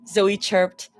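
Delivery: ordinary delivery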